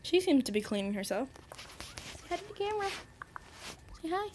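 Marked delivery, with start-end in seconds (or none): 2.1-4.3 pet coaxing. Real, not acted. Owner asking pets to say hi, soft high coaxing. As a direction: coax a little animal softly, up high.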